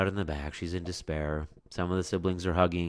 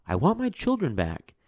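pleading mother; 9 semitones higher than usual. Acted. Mother pleading at witch's door.

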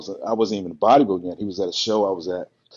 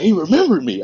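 excited; 9 semitones higher, 4 dB louder than usual. Retold or imitated. Thrilled at being remembered.

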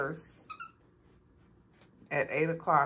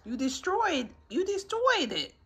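much higher, outraged pet voice; high mock accusation; 13 semitones higher, 3 dB louder than usual. Real, not acted. Owner blaming the dog for the destroyed toy, high mock outrage. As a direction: squeal the blame at the dog.

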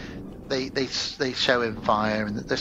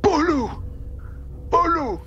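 caveman shout; slower than usual. Retold or imitated. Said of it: "the caveman wakes up and he says".

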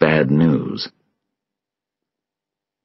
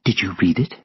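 hushed, tentative question; subdued character voice; Sylvia's voice; 3 semitones higher, 4 dB quieter, faster than usual. A narrator's reading. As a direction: ask it quietly, grieving. Narrator voices grieving Sylvia asking.

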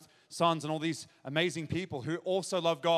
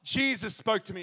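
loud, higher-pitched testimony, ringing out; impassioned preaching; 5 semitones higher, 6 dB louder than usual. Real, not acted. Preacher tells the crowd who got saved, impassioned preaching. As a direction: preach it with mounting excitement.

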